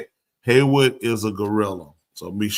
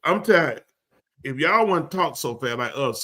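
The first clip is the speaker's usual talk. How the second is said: ordinary delivery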